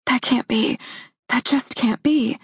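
high, breathless denial; stunned disbelief. A narrator's reading. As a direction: whisper it in shock. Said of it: "I say when I can finally speak".

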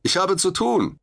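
defiant call from above; calling back down. A narrator's reading. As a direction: call down from the trapeze, brushing him off. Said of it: "rief ich zurück".

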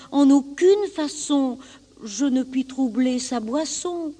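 high-pitched lamb reasoning with a king; pleading lamb. Acted. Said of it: "répond l'agneau".

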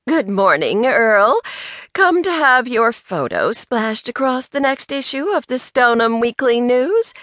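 overly sweet, needling question; sickeningly sweet. A narrator's reading. Angelica needles Earl about the photo, sickeningly sweet. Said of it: "her voice sickeningly sweet".

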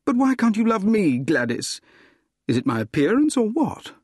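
high-pitched lovelorn protest; acted hurt appeal; pleading. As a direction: demand a reason, pitch soaring then falling. Rejected suitor pleads for a reason.

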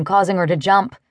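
ordinary delivery, nothing marked

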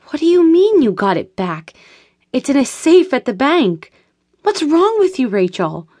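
sharp, baffled questioning; incredulous. A narrator's reading. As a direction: question her sanity. Anita disbelieving.

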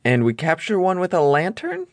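nervous first mate asking anxiously; Smee voice. A narrator's reading.

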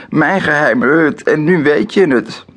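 hoge, luide kinderklacht; roepend. A narrator's reading. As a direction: roep het hoog en huilerig uit. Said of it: "roept ze". (huilerig roepend kind)

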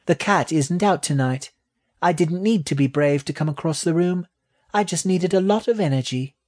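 high little rat voice; small creature changing the subject, high-pitched; sulky baby rat. A narrator's reading. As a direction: speak high as a sulking young rat.

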